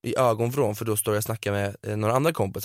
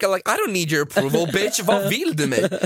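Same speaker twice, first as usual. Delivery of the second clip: mock outrage; 8 semitones higher, 4 dB louder, slower than usual. Retold angry comeback.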